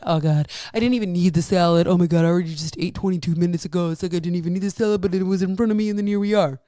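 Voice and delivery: fast, fretful inner voice; mock panicked self-talk. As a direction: rattle it off like a guilty inner monologue. Client's panicky self-talk about salad.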